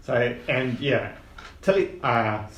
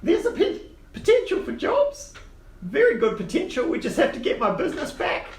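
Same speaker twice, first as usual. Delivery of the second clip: put-on high old gnome voice, eager; squeaky little uncle selling a job; 10 semitones higher than usual. GM holds Quince voice.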